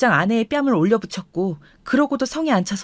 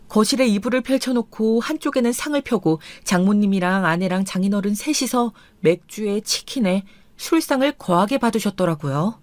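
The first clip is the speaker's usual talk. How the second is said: usual speaking voice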